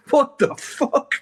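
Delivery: high, slow, laughing disbelief; real amazement through a laugh; stunned laugh. Real, not acted. Phone call from his idol.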